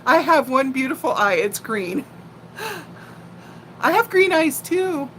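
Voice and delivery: usual speaking voice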